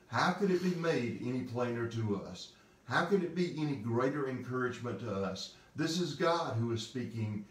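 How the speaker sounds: ordinary talk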